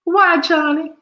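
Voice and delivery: high-pitched flirty woman's voice; swooning woman.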